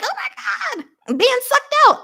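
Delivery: put-on very high, squeaky panicked baby voice; mock baby voice. Mocking a baby in abortion videos, very high squeaky voice.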